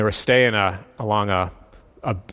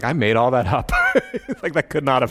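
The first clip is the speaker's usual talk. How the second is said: laughing. Retold or imitated. Retold morning doubt, laughing.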